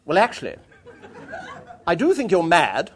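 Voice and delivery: imitated forthright countrywoman; English countrywoman. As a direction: give a blunt verdict as a country lady, then chivvy. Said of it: "archetypal English countrywoman".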